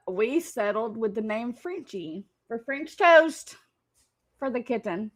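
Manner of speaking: ordinary talk, nothing marked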